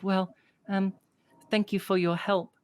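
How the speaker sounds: put-on a small girl owning up to the barkeep; little girl apologizing for the bird. Little girl asks names.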